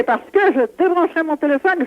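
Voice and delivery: ordinary talk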